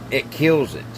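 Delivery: normal talking voice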